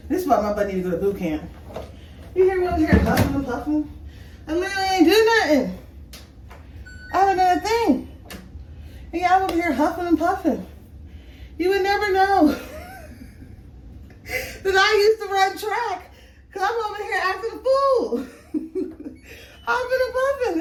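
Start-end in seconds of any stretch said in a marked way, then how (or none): 2.3-12.5 amused exasperation. Real, not acted. Laughing at own huffing and puffing. 12.5-14.0 a sudden high squeal of laughter. Real, not acted. Vlogger laughing at her own huffing, high squealing laugh. 14.2-20.6 laughing at herself. Real, not acted. Vlogger mocking her lost track fitness, laughing high.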